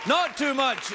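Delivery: high, loud welcome over the applause; loud show greeting. Real, not acted. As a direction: call out to a cheering studio crowd. Host greets cheering studio audience.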